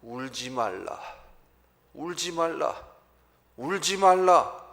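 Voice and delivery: building from calm to a loud cry; Jesus telling the widow not to cry. Acted. Pastor voicing Jesus comforting the widow, swelling to a loud cry. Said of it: "그런 과부를 보시고 불쌍히 여기사".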